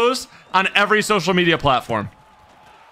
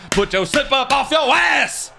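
shouted lyric; 8 semitones higher than usual. Pretend.

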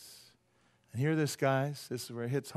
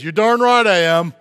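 put-on loud, higher boastful old man; proud old guy; 4 semitones higher, 15 dB louder than usual. Old guy admitting his pride to the preacher, loud boast. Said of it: "this old guy comes up to me".

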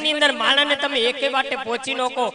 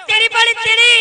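loud, high lisping shout; comic tongue-tied yell; mock shout; 8 semitones higher, 9 dB louder than usual. Stuttering man shouts at girl.

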